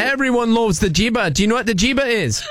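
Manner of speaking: broad put-on Aussie accent; Australian accent. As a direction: greet them in a hammed-up Australian accent. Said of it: "with this goofy accent".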